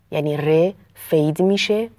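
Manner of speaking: ordinary delivery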